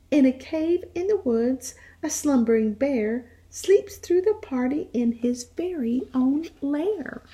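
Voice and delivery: put-on lively read-aloud voice for young children; animated picture-book reading; storytime narration. A narrator's reading.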